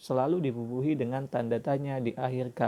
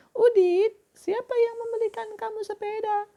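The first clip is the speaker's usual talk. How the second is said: put-on very high girlish voice; girl's voice; 4 dB louder than usual. Shown as a demonstration. Teacher voicing Siti for the class. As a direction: squeak it out like little Siti. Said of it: "suara cewek".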